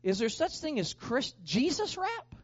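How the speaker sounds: imitated mocking question, pitch jumping on Jesus rap; making fun. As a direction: tease with a jump up on the last words. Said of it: "tried to make fun of me".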